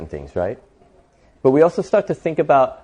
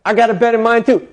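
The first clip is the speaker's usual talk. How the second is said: high, loud bragging; excited boast; 10 semitones higher, 5 dB louder than usual. Acted. Driver showing off new bed to woman, excited boast. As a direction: announce it like a proud show-off.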